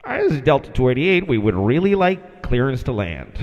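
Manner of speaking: low voice, quick call-in then insistent request; pilot on the radio. Retold or imitated. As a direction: press politely as a cockpit pilot. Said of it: "our guy trying to get clearance".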